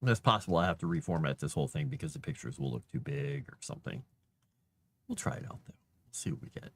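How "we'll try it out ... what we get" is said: soft unvoiced whisper; whispered to himself. Real, not acted. Streamer reformatting pictures, whispering to himself. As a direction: murmur it under your breath. Quiet aside while formatting.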